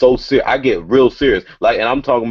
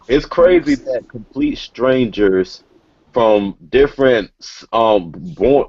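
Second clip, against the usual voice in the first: ordinary talk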